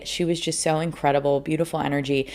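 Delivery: ordinary talk, nothing marked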